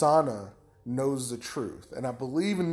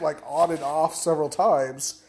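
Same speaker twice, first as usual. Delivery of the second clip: ordinary talk, nothing marked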